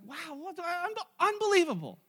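high, stammered astonishment; acted amazement. Onlookers seeing Lazarus alive, acted stammering amazement. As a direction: stammer in disbelief at a miracle.

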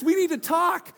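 alarmed ominous read. Shown as a demonstration. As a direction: make it land like a threat, loud and high. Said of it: "you're reading it like".

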